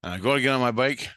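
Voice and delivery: ordinary talk, nothing marked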